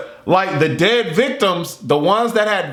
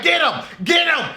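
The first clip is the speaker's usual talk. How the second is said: cheering scream; 10 semitones higher than usual. Retold or imitated. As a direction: yell get him like a fan at a fight. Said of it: "I'm screaming and stuff".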